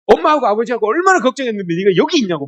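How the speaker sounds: high-pitched worried scolding; imitated parental reproach. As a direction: act out a parent's exasperated reproach. Voices worried parents scolding child.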